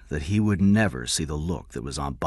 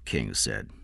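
ordinary delivery

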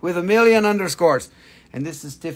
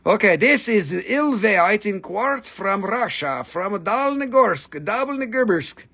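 ordinary talk

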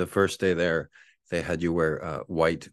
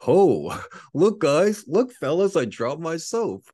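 high, loud, playful joke call; high chirpy joke voice; jokey announcement; 11 semitones higher, 7 dB louder, slower than usual. Retold or imitated. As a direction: sing it out like a goofy prank. Mock cheery joke in shower.

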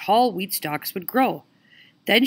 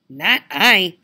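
grunting pig; 6 dB louder than usual. Acted. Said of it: "grunted the rude pink pig".

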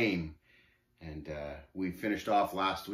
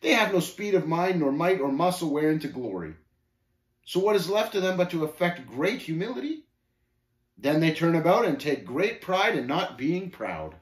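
higher, animated scoffer; lightly voiced scornful Shame, deriding; 6 semitones higher, 4 dB louder than usual. Shame running down the humble to the pilgrim, derisive. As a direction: lecture with mild derision.

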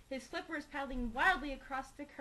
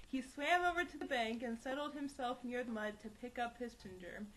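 usual speaking voice

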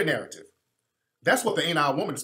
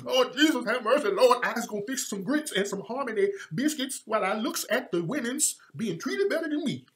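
higher-pitched caricatured Southern plantation voice; hammed-up mock dialect lament; 4 semitones higher than usual. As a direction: wail piously in exaggerated plantation talk. Mocking the written plantation dialect.

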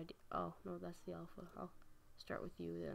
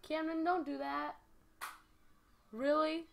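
louder and higher, scolding; annoyed call; 8 semitones higher, 7 dB louder than usual. Real, not acted. Older sibling telling off little brother Camden, annoyed call. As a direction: call out sharply to make him stop.

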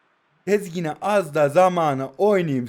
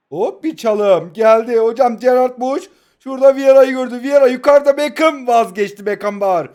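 louder, lively match commentary voice; play-by-play commentary; 6 semitones higher, 9 dB louder than usual. Acted. Mock live commentary of his play. (heyecanlı maç spikeri anlatımı)

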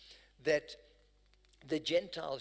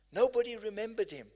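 louder, higher preaching; 5 semitones higher, 5 dB louder, faster than usual. Acted. Preacher rises in intensity.

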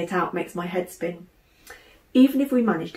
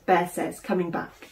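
plain reading voice

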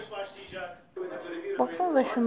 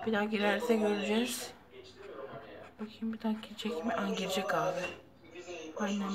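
quiet voice; 5 semitones lower, 10 dB quieter than usual. Real, not acted. Quiet filming near nest.

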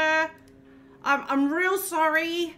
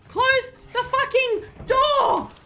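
drawn-out desperate yell; panicked yell; 8 semitones higher, 4 dB louder, much slower than usual. Real, not acted. Player yelling at the monster in a panic.

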